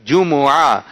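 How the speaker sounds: جمعہ in three clear syllables, ju-mu-aah; correct pronunciation. Shown as a demonstration. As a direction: teach جمعہ with a u after the m and an open long ending. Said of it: "تو یہ اس کا درست تلفظ ہے".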